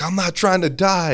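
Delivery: high-pitched imitation of a panicking recruit; mock freaking out. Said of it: "because he's freaking out".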